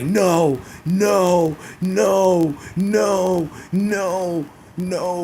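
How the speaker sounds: high, drawn-out wailing no's, starting loud and fading; hammed-up shocked whining, each no stretched out; mock crying Paul Heyman. Vlogger mimicking a manager's panic at the wrestler's return, mock crying. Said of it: "Paul Heyman starts crying and gets shocked up".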